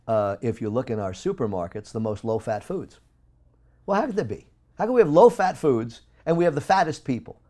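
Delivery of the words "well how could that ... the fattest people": much higher and louder, fired-up indignation; impassioned outcry; heated rant. Real, not acted. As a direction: rail against the food industry, voice climbing. Ranting about sugar in low-fat foods.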